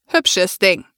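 cold, croaking electro voice; cold electronic voice. A narrator's reading. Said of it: "krächzt Ravens kalte Elektrostimme". (kalte krächzende Elektrostimme)

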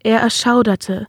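ordinary delivery, nothing marked

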